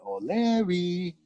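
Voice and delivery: slow, strange invented warlord tongue, rising on the last word; drawn-out gibberish, stretched and sung up at the end; made-up language. Retold or imitated. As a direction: Attila calling to Larry in invented speech. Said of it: "and I went".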